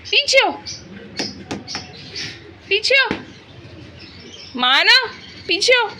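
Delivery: real angry yell at a pet; scolding shout. Real, not acted. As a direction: shout it sharp and high at the pet.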